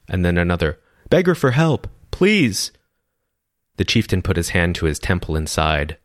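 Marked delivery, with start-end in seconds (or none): 1.1-2.7 lightly voiced, much higher plea; a desperate voice begging from the crowd; higher pleading cry; pleading villager. A narrator's reading. Another voice from crowd.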